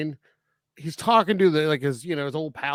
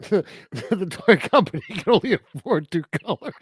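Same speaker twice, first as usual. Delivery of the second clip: words shaken by giggles; laughing; 3 semitones higher than usual. Real, not acted. Joking to co-host about the cheap toys, laughing. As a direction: laugh all the way through the line.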